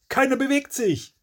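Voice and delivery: very high-pitched urgent command; high, strained shout in the dark; bellowed order. A narrator's reading. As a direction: yell for everyone to freeze. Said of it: "brüllt der Gesunde".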